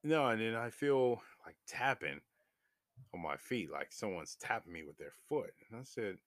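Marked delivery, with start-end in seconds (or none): none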